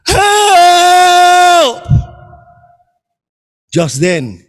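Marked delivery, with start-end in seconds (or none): 0.1-1.8 loud high desperate yell; cry for help. Acted. Oba hanging from a branch, yelling loud and high. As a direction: shout up the mountain for rescue. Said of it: "He says".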